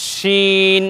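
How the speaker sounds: শিন with a thick, airy sh and long vowel; airy sheen. Shown as a demonstration. Teacher contrasts it with seen, thick airy শিন. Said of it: "বেশি বাতাসের সাথে উচ্চারণ করতে হবে".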